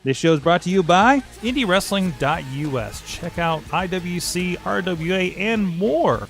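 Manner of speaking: loud, punchy sponsor plug over music; ad read. Acted. Host reading the sponsors to listeners, in a booming ad voice. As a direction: sell it like a radio announcer.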